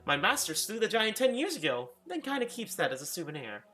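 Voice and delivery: a jittery maid deflecting with a laugh; nervous housekeeper. Acted. Housekeeper laughing off the guest's questions, high and nervous. Inara again.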